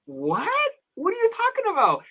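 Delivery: very high-pitched incredulous squeal; comically high, stunned questioning; shocked disbelief. Retold or imitated. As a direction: react in disbelief, voice shooting right up. Retold shock at eight baby mamas.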